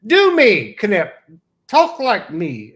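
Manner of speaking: high, loud mock demand in her voice; a light take on the old atheist friend; imitating O'Hair. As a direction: bark the request the way she would. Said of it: "my impersonation of her".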